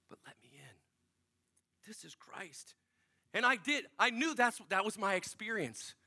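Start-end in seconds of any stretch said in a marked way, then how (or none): 0.1-2.7 very soft whisper; gentle whispered plea. Retold or imitated. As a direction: whisper it as a tender request. Voicing Jesus at the door.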